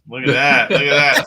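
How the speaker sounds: loud teasing shout. Real, not acted. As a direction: call it out across the room. Teasing cohost leaving to pee.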